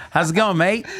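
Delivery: retry a shaky Australian accent after laughing. Shown as a demonstration. Guest retrying his failed impression, hammed-up Australian accent with "mate". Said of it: "your best Australian impression".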